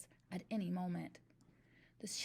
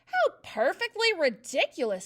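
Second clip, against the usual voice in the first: higher, much louder scornful snort; 7 semitones higher, 10 dB louder than usual. A narrator's reading. Headmistress scorning the spelling song, snorting. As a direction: scoff at it out loud. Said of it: "snorted the Trunchbull".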